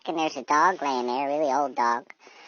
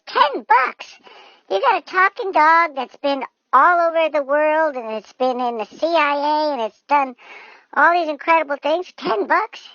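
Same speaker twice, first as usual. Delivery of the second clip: high, loud outraged disbelief; hammed-up flabbergasted outburst; incredulous; 11 semitones higher, 6 dB louder than usual. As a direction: protest in a raised, astonished voice. Man incredulous at low price.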